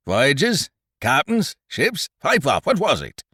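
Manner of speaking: high, rising one-word questions; impatient interrogation. A narrator's reading. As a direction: fire guesses at him, demanding an answer. Silver fires questions at Morgan.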